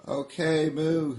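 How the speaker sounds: high, loud cow voice; a cow calling out; high loud moo voice; Desi the cow. Acted. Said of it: "says Desi".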